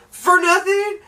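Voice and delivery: high-pitched incredulous squeal; outraged disbelief. Acted. Reviewer fuming at pointless plot, high incredulous outrage.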